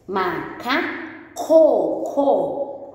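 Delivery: "Macaco" dragged out syllable by syllable; teacher stressing the final "co" in "Macaco"; very slow sounding out. Shown as a demonstration. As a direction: draw "Macaco" out slowly so the last syllable stands out. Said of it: "Só o final que nós temos que escrever".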